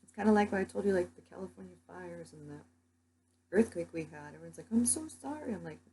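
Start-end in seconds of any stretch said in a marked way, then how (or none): 4.7-5.5 high, sing-song mock pity; high sing-song mock sorry; mock sympathy. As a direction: parody gushing sympathy. Mocking people's sympathy.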